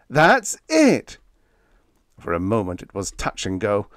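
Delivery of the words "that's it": high, drawn-out triumphant yell. A narrator's reading. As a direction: shout it out in sudden discovery. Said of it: "he yelled".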